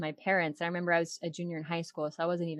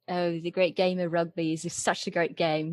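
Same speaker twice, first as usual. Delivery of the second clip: Australian accent. Shown as a demonstration. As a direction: lean into the Australian vowels. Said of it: "makes my accent come out a little bit better".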